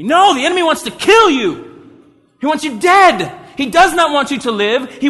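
high, loud preaching rebuke; urgent warning. Real, not acted. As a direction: hammer the warning home.

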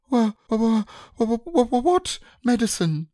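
a piglet dreading medicine; stammering alarm. Acted. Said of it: "said Piglet".

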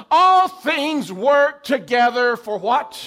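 high, slow call for response; preacher call-out. Acted. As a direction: draw out the question to the crowd. Calls for congregation response.

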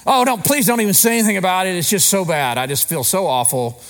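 mock pleading, fast and high at first, then sinking; hammed-up woe-is-me protest; self-pity. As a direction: beg off in exaggerated self-pity, easing down at the end. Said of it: "wallow in our self-pity".

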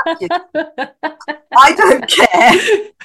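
high, loud, slow exasperated curse with a laugh; irritated outburst. Retold or imitated. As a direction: fling the words away, half laughing. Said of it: "And I thought".

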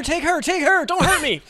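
high loud falsetto begging; screaming at burglars to take her; mock panic. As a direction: sell out the girlfriend in a hammed-up squeal. Acted robbery panic.

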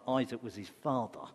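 usual speaking voice

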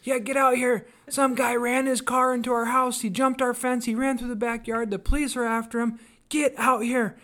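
high, loud and urgent; wife bursts in, urgently calling her husband outside; urgent alarmed call. Retold or imitated. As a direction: yell him out of the shower in alarm. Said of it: "Bob busts in our bathroom going".

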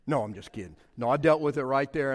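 normal talking voice

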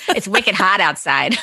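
slow, laughing Boston accent. Shown as a demonstration. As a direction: drawl it out in a mock Boston accent. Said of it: "Can you do a Boston accent?".

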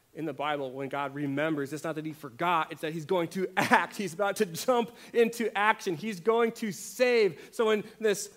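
from strong to loud, slowing on save; building preaching. Acted. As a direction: build it up until the last word lands.

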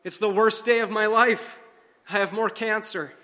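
a mild retold anguished blurt. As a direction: let it burst out of her. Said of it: "lost control of myself and blurted out".

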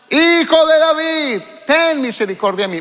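grito agudo y lento; clamor insistente. Acted. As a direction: clama con más fuerza que antes. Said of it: "él clamaba mucho más". (clamor agudo del ciego, alargando "Hijo")

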